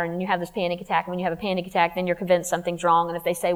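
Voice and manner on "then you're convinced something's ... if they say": normal talking voice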